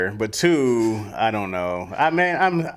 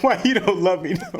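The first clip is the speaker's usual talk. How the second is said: pitched-up mock pleading; abandoned bike; 6 semitones higher than usual. Bike asking owner about lost love, mock pleading. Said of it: "Your bike was like".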